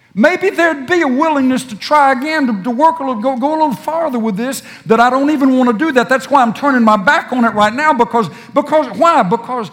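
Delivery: pleading sermon climax; impassioned preaching. Acted. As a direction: cry it out in mounting urgency. Preacher voices weary believer.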